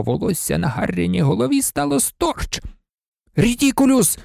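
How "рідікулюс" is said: high, excited spell cry; mild high-pitched shout; Seamus's exclamation. A narrator's reading. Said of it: "вигукнув Шеймус".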